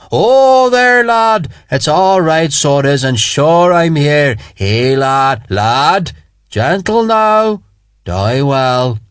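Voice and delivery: high Irish lilt, calling out to calm him; Irish sergeant soothing. A narrator's reading. Irish sergeant calling out to calm a screaming soldier, hushing. Connolly comforting screaming man.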